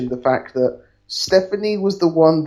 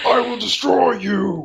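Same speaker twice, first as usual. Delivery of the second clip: mock monster threat; 7 semitones higher, slower than usual. Kane with mask back on.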